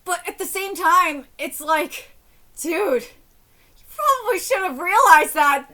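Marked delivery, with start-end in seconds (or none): 2.6-5.7 slow, louder exasperated lecture; exasperated scolding. Real, not acted. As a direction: drag it out like talking to a clueless friend. Scolding the story's husband.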